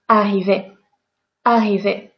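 don't lengthen the RR in Arriver; double R as one. Shown as a demonstration. Teacher showing double R pronounced as a single R. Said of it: "It doesn't matter. One R, two R".

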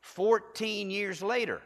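slow and drawn out, high; slow emphasis. Shown as a demonstration. Preacher slowing down so the church hears closely. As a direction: lean on it slowly for the crowd. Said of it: "I want you to hear this closely".

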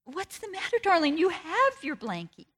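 high, sugary "darling" from a tired mother; mother coaxing her son gently, with a touch of strain; mock-tender mother asking her son what is wrong; sweet mom voice. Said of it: "I said".

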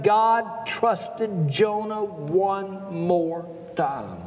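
insistent run of rhetorical questions; fervent pulpit challenge; impassioned preaching. Acted. Preaches at full force.

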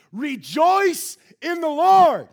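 slow, loud, high proclamation; proclaimed shout. Acted. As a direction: cry it out to the whole church. Preacher proclaims scripture.

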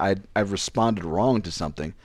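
ordinary talk, nothing marked

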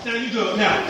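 ordinary talk

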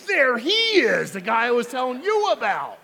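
hammed-up wife sneering at the TV pastor; wife loudly mocking the husband's praise; wife's scornful echo. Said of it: "his wife standing next to him says".

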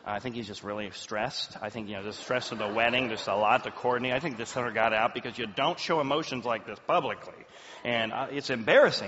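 low, confidential mock excuse-making; apologetic relatives. Wedding guests apologizing for crying groom, low apologetic imitation. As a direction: imitate embarrassed guests explaining it away.

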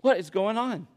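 ordinary talk, nothing marked

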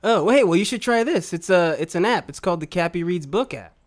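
commercial voice. Acted. Upbeat commercial skit pitch.

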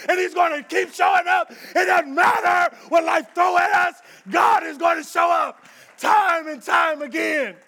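loud, high building climax; full-force preaching. Acted. Preacher promising God shows up, loud building shout. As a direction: let it swell into a shout.

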